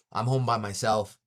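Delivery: usual speaking voice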